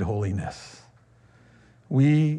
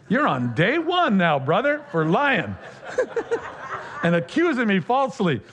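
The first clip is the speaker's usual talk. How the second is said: teasing through a burst of laughter, a bit higher; laughing tease; 6 semitones higher than usual. Retold or imitated. Pastor teasing the accusing man, laughing as he speaks. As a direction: laugh while needling him.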